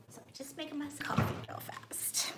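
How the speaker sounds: soft unvoiced whisper aside. Real, not acted. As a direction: whisper it while fixing coffee. Whispers aside while making coffee.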